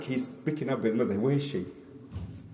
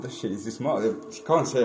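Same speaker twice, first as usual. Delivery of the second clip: ordinary delivery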